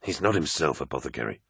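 low, subdued explanation; explaining. A narrator's reading. Loken to apothecary about the First Captain, explaining. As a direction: calmly excuse a comrade, dropping off softly. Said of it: "explained Loken".

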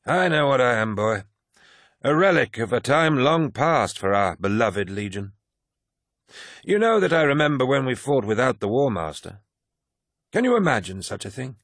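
put-on garrulous old warrior holding forth; old veteran Cruz. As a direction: lecture the young man like a proud old-timer. Narrator voices old Cruz.

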